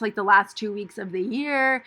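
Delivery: normal talking voice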